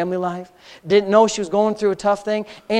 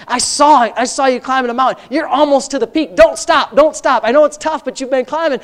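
louder, higher, pressing exhortation; urgent preaching; 5 semitones higher, 5 dB louder than usual. Acted. Pastor urges his struggling cousin on the phone, urgent exhortation. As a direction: push her on, don't let her quit.

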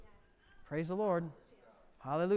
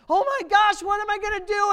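high, loud mock panic; panicked cry; 12 semitones higher, 9 dB louder than usual. Worried churchgoer facing a broken car, loud panicked cry. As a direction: throw up your hands in a panic.

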